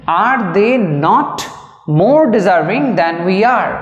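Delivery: very slow, each word drawn out; slow dictation. Shown as a demonstration. Teacher dictating to students, very slow and spaced. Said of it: "dictating the full sentence".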